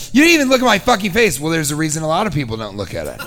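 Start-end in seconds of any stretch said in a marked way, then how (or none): 0.1-1.4 higher, louder, fast incredulous repeat; mock outrage. Comedian mocking the woman's line, higher and louder in disbelief. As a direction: fire it back in disbelief. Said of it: "expect me not to be like".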